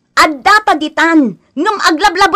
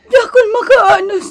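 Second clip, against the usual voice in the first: higher, crying voice; tearful plea; 6 semitones higher than usual. Acted. Wife cries to her husband about his mother, tearful. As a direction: let the tears break through the words.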